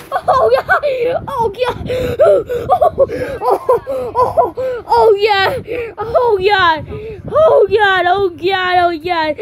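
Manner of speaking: panicked yelps between laughs; shaky, frightened shouting after a scare; terrified gibbering. Real, not acted. Reaction to a big jump scare.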